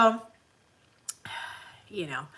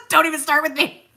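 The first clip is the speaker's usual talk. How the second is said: laughing through a mock warning; play-tough line broken up by laughter; 9 semitones higher, 10 dB louder than usual. Retelling her reply to the rent email while laughing.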